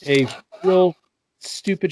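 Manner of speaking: normal talking voice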